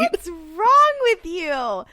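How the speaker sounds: loud, high, drawn-out mock outrage; mock scolding. Co-host berates invisible teen in street, mock scolding. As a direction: scold in exaggerated exasperation.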